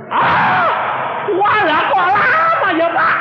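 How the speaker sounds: very high, loud wail; exaggerated high-pitched groaning protest; mock dismayed outcry. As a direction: cry out in hammed-up dismay at the wait. Acted dismay at the long wait.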